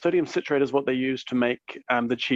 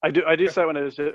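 ordinary talk

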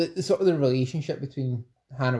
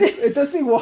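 high, loud laughing protest; real laughter; 13 semitones higher, 6 dB louder, slower than usual. Real, not acted. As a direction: crack up at your own failed attempt. Cracking up at failed accent.

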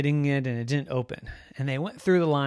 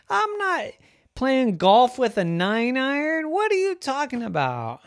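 put-on exasperated little girl; 10 semitones higher, 6 dB louder than usual. A narrator's reading. Girl argues with Mommy.

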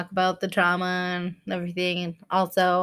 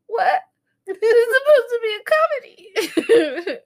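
very high, whimpering disbelief; mock tearful protest; 12 semitones higher, faster than usual. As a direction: whine it through fake tears and laughter. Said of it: "I could watch a commercial and cry".

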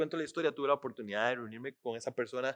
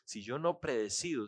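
ordinary talk, nothing marked

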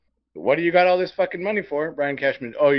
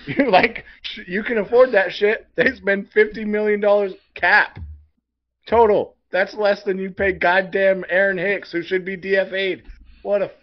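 fired up; 5 semitones higher, 5 dB louder than usual. Real, not acted. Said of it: "I'm getting more fired up the longer I go".

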